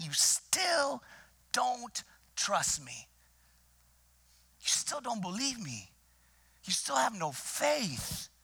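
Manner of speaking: hurt friend asking why they don't trust; Jesus pleading with his disciples in the boat, exasperated. Acted. Preacher voices Jesus pleading at length.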